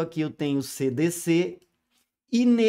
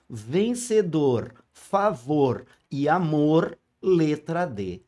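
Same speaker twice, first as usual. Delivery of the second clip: drawn-out rhyme reading; slow rhyme demo; slower than usual. Shown as a demonstration. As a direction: stretch each rhyme word slowly. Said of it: "que é a letrinha D". (leitura lenta das rimas)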